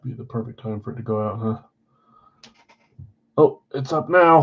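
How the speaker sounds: ordinary talk, nothing marked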